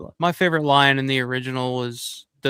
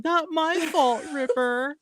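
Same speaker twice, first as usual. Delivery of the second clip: high, slow, nasal-free whine stretched out; whiny punk girl; 11 semitones higher, slower than usual. Retold or imitated. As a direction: drawl it like a dubbed punk girl. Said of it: "I say that all the time".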